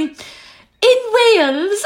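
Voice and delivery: high, drawn-out hammed-up Welsh lilt; effeminate Welsh accent. Imitating a man playing Leanne, effeminate Welsh accent. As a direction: imitate a bad, girlish Welsh accent, laughing. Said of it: "this really effeminate Welsh accent".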